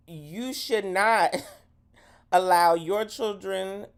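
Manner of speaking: ordinary talk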